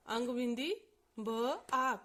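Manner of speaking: ordinary delivery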